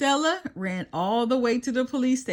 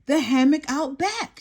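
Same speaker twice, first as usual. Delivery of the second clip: gasped; 5 semitones higher than usual. A narrator's reading. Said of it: "she gasped".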